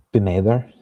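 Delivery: normal talking voice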